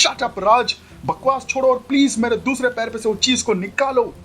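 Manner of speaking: high, exasperated scolding; irritated young woman voice; annoyed young bride. Acted. Sonia voiced higher than narrator.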